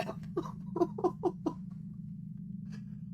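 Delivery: silent shaking laugh; breathy unvoiced laugh. Real, not acted. Breaks into laughter.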